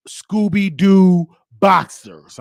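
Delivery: slow, loud punchline; drawn-out shout of the punchline; loud comic reveal. Real, not acted. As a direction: stretch it out big for the laugh.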